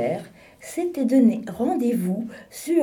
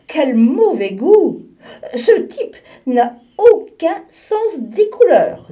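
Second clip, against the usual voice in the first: higher, louder, laughing scorn; mocking laugh; 10 semitones higher, 9 dB louder than usual. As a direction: laugh at the walrus's bad taste. Mocking the walrus's scarf.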